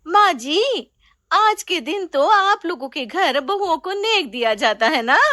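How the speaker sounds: hint slyly for a gift; young bride's voice. Acted. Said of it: "चाची सास से कहती है". (बहू सास से नेग माँगती, ऊँची आवाज़ में)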